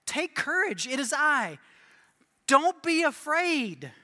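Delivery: lightly acted commanding reassurance; reassuring Jesus. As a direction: call out slowly to steady frightened friends. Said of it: "Jesus goes".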